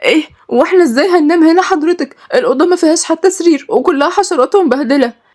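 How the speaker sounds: high, panicked protest; terrified. A narrator's reading. Girl horrified at the filthy room. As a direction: cry out in dismay. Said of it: "ردت ملك برعب".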